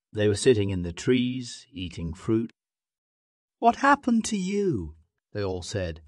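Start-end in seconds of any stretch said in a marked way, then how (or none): 3.6-5.0 high-pitched astonished question; shocked question. A narrator's reading. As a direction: ask in amazement, voice pitched way up. Monkey relatives are shocked.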